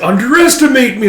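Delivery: high, loud defiant attack cry; battle cry. Retold or imitated. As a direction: roar it as the punch flies. Fighter's cry before punch.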